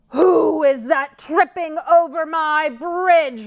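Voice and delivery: loud, slow troll roar; a big troll roaring. Acted. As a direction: bellow it out from under the bridge. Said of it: "roared the troll".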